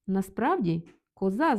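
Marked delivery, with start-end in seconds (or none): 1.1-1.6 коза stressed on its last syllable, А; stress on final А. Shown as a demonstration. Teacher flagging a grammatically incorrect form, stress on final А. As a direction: put the weight on the А ending коза. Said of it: "з наголосом на А".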